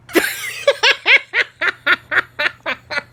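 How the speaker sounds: loud, high laugh at the punchline; big laugh. Real, not acted. Teacher laughing at his dad's fall, loud high laugh. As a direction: burst out laughing.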